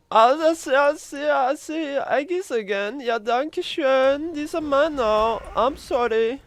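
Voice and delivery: high, hammed-up German accent, slow. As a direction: gloat over the eggs in a silly German accent. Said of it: "I'm suddenly a German guy".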